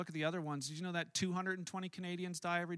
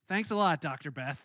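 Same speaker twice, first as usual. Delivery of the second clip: sarcastic thanks; 3 semitones lower, 7 dB louder than usual. Retold or imitated. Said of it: "I was like".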